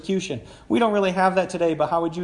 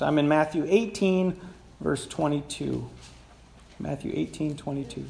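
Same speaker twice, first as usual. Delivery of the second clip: ordinary talk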